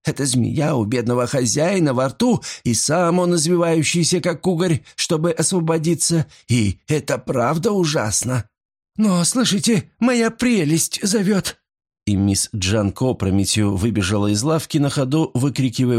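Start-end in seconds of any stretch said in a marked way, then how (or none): 0.0-8.5 maid's voice. A narrator's reading. Maid Deborah's voice. 9.0-11.6 high, breathless, alarmed maid; excited. A narrator's reading. Maid hears her mistress calling.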